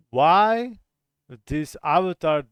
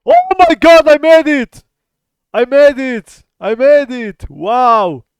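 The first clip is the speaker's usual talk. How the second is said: excited shout; 11 semitones higher, 18 dB louder than usual. Real, not acted. Wins the banana guessing game.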